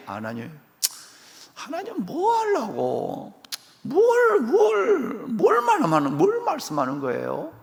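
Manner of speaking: high, drawn-out whiny questioning; Abraham whining back at God; doubtful Abraham. Acted. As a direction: squeak out suspicious questions to God.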